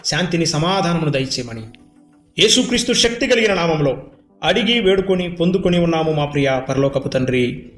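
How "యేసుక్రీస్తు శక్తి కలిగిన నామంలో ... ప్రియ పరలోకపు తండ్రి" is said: ordinary talk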